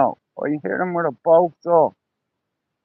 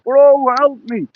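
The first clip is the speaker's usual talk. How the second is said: pleading shout; 6 semitones higher, 4 dB louder, slower than usual. Retold or imitated. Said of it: "you know".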